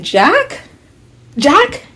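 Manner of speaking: high rising, questioning disbelief; high-pitched shocked query; incredulous. Retold or imitated. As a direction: say the name like you can't believe it. Acts out disbelieving reaction.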